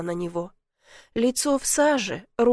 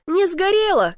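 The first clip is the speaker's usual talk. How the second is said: higher and louder shout to the window; 8 semitones higher, 4 dB louder than usual. A narrator's reading. Dobrynya shouting out to the neighbour outside, loud reassurance. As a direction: yell it out to the neighbour. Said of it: "крикнул Добрыня".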